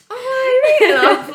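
hammed-up certainty; imitating the friend sure of her guess; mock confident friend. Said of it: "and she was like".

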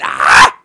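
sudden loud, low yell; excited yell. Real, not acted. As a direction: burst out with an excited "ah". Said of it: "I got really excited".

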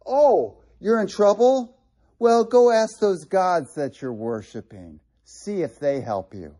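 mock them for trusting idols; sarcastic. God taunting his idol-worshiping people, sarcastic. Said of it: "That's sarcasm".

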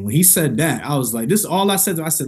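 usual speaking voice

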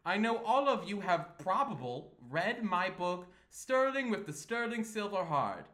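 showman plugging a book, slowing on the title; flamboyant Sterling. Acted. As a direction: boast to the audience theatrically. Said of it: "he's kind of, like, flamboyant".

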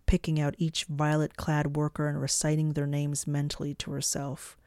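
usual speaking voice

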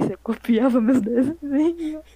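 laughing through the words. Real, not acted. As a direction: breaks into laughter mid-question. Laughing while telling the story.

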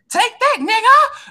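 high, slow, mocking squeal; mocking taunt. Boston fan mockery in Winning Time.

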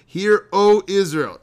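"O" punched high and loud, slowly; stress on O. Shown as a demonstration. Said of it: "Is it just for emphasis?".

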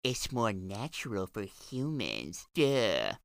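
mock them with a slow, dopey drawl; mocking quote. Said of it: "Practitioners of this diet claim".

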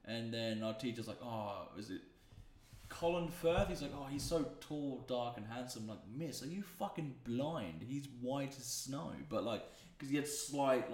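usual speaking voice

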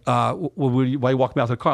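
retold stammer on the first word; imitating a flustered stutterer; nervous stuttering. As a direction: stumble and stall before getting the question out. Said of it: "stuttering 'cause he knows".

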